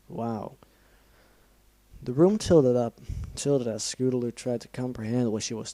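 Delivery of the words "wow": plain reading voice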